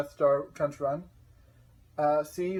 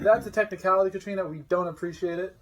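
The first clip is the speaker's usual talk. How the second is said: mock outrage; 4 semitones higher, 7 dB louder than usual. Mock outrage at trick question.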